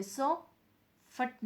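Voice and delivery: सो फट as two separate pieces with a pause between; word wrongly split. Shown as a demonstration. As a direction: demonstrate a false syllable break in सो फट. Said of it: "सॉफ्ट को आगे हम और break नहीं कर सकते".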